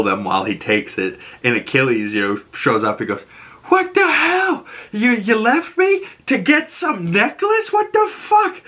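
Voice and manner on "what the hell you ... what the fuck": much higher, outraged shouting; betrayed fury, voice cracking up high; pissed off. Retold or imitated. Achilles catching Memnon taking the necklace, outraged high yell. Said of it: "all pissed off".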